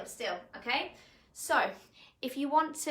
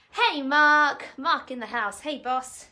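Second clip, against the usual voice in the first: Hey, Mark called out much louder; loud greeting; 12 dB louder, slower than usual. Real, not acted. Instructor welcoming Mark to the class, loud call. Greeting a viewer joining live.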